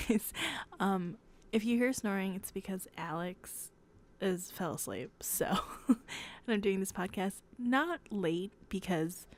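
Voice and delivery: normal talking voice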